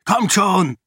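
high, loud urging; urgent call to follow. Acted. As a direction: push them to hurry. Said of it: "drängte Raoden".

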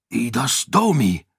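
understated curse in character; muttered oath. A narrator's reading. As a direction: swear with a touch of dismay. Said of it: "fluchte Raoden". (verhaltener Fluch)

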